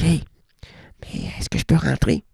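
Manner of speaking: slow timid whisper. Acted. Visitor whispering to the doorman to be let in. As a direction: ask it under your breath.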